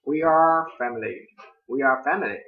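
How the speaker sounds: we are family with a strong American R; English R sounds. Shown as a demonstration. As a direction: curl the tongue for the R in family. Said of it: "Ví dụ như".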